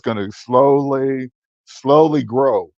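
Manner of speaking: deliberately slow, hypnotic drawl; drawn-out slow words. Real, not acted. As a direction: stretch each word out, very slow. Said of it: "why are you talking like that".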